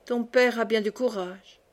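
plain reading voice

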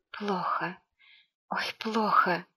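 hushed, weak moan; quiet, feeble complaint. A narrator's reading. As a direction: say it faintly, half whispered. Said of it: "тихо сказала Ксюха".

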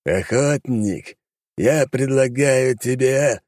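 slow, high, drawn demon voice; rumbling demon. Acted. Demon starts bargaining with the hunter, slow and rumbling. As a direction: roll the words out like a monster making an offer. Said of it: "пророкотал демон".

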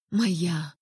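ordinary delivery, nothing marked

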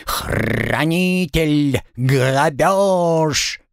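slow squawked parrot cries; bird folk. Acted.

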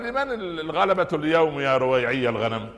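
lightly acted scornful challenge, high and loud; Abu Jahl. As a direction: call out a haughty question from on high. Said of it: "أبو جهل وهو يموت".

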